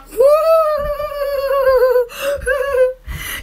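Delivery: drawn-out, high giggling fit; long laugh. Real, not acted. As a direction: dissolve into giggles. Laughing at toilet humour.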